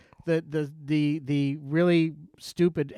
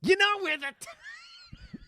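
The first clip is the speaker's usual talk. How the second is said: high, slow, shouted impression; yelling impression; 4 dB quieter, slower than usual. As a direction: screech the quote out in a cartoonish yell. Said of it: "I'm terrible at doing impressions".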